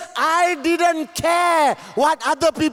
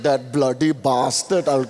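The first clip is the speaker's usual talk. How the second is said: muttered inner curse; 13 semitones lower than usual. Pretend. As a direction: swallow the anger and grumble it low. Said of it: "You're saying".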